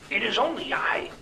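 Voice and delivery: high, whispery gruff little voice; small gruff voice. A narrator's reading. Talking train revealing himself to his engineer, small gruff voice. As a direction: speak as a tiny, gruff creature. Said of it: "a small, gruff voice".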